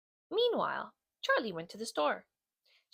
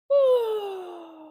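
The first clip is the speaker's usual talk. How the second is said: bored sigh; 10 semitones higher, 6 dB louder than usual. Acted. Bored Otis on the couch.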